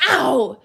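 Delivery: sharp loud yelp of pain. Real, not acted. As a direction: cry out at a sudden sting. Cut hand hurts while grabbing.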